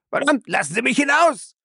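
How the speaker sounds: panicked yell. Acted. Drowning man screams.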